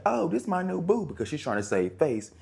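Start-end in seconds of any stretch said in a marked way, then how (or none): 0.0-1.0 high sassy voice showing off; acted quote. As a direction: show off the new man to the ex, high and sassy. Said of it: "she's like".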